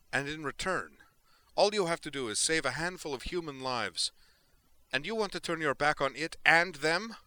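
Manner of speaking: loud, high, furious tirade; wound-up anger boiling over; blazing outburst. A narrator's reading. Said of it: "she turned to him and blazed".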